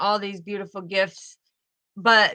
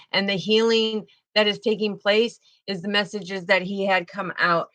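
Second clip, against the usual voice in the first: ordinary talk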